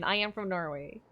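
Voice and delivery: usual speaking voice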